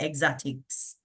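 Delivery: "exotics" in a Jamaican accent. Shown as a demonstration. Host showing how it is said at home, Jamaican-accented "exotics". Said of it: "how we say it in Jamaica".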